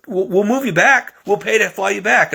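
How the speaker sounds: a hint of a pleading office lady; eager recruiter. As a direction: play the recruiter pushing hard to win him back. Recruiter insists, voiced woman.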